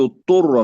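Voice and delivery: اضطر with ض merged into ط; اضطر with no separate ض; wrongly merged dad. Shown as a demonstration. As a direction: swallow the ض of اضطر into a doubled ط. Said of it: "لا نقول".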